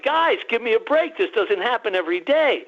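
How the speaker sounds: retold pleading protest. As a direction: plead your case, lightly acted. Retold plea to the aliens.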